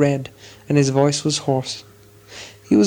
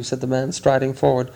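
plain reading voice

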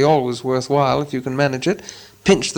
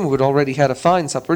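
ordinary delivery

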